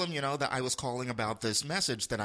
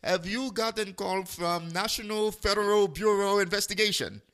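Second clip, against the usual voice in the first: put-on higher Indian-accented scammer voice; bad fake Indian accent; 6 semitones higher, 4 dB louder than usual. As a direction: ham up the caller's Indian accent. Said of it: "This is a very bad fake accent".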